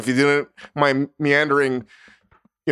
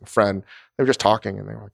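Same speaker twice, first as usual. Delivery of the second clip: ordinary delivery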